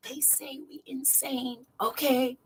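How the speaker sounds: very soft, voiceless aside; hushed mock-confiding whisper; playful whisper. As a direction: whisper it like a secret, playing around. Teasing aside before stopping playing.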